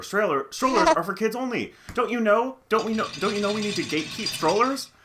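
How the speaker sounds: high, loud sarcastic mom impression; sarcastic parody of the rude mother; mocking entitled mom. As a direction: ham up the outraged gatekeeping parent. Mocking the mom's attitude.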